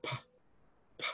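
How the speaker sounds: "p, p" puffed with no voice, just air; breathy aspirated "p, p"; voiceless p sound. Shown as a demonstration. Teacher showing class a voiceless p, aspirated. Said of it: "a voiceless P sound".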